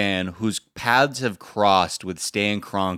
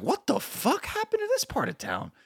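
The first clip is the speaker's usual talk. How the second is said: high-pitched, softer incredulous squeal; mock bewilderment; 6 dB quieter than usual. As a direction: act out baffled disbelief, voice shooting way up. Astonished inner reaction, retold.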